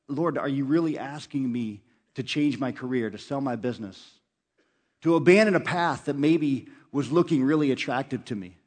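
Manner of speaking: ordinary talk, nothing marked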